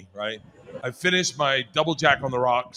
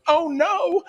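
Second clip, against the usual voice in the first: shocked oh no; 12 semitones higher than usual. Retold or imitated. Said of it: "she just goes".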